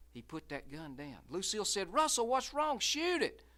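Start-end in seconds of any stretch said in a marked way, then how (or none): 1.9-3.4 much higher, louder, pushing him; urgent plea. Acted. Lucille urging Russell to shoot, high and urgent. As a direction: Shoot it, pressing hard.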